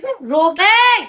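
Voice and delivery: high, loud snap; mother shouting at her crying daughter; sharp shout. Real, not acted. Mother snapping at crying child.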